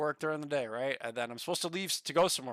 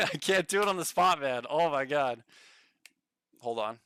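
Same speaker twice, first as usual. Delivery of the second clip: ordinary talk, nothing marked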